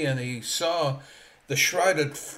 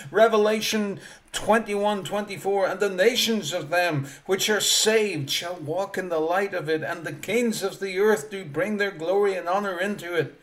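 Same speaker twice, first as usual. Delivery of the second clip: loud, raised preacher's reading; preaching scripture; 3 semitones higher, 3 dB louder than usual. Acted. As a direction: declaim the verse to the hall.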